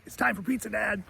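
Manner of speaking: high-pitched mock kid yell, held back; Buzz. Buzz interrupts his dad for pizza, mock high yell. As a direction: imitate an excited boy hollering, high but softened. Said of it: "Buzz comes storming down the stairs".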